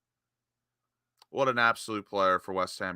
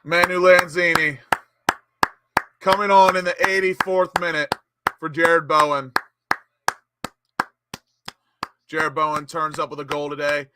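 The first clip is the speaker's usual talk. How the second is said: higher, announcer-style match commentary; live play-by-play; 6 semitones higher, 4 dB louder than usual. Real, not acted. Commentator hailing a club legend coming on, raised announcer voice. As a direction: call the substitution with a raised, projected voice.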